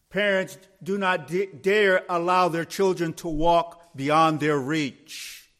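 high, slow, emphatic sermon delivery; forceful preaching. Real, not acted. Preacher warns the congregation there is no safe place, forceful preaching. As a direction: preach it out, building to loud, drawn-out phrases. Preacher builds through a list of dangers.

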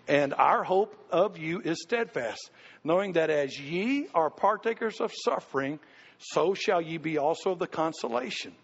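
slow, measured Bible reading; scripture reading. Acted. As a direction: read the verse slowly and solemnly. Reads scripture aloud.

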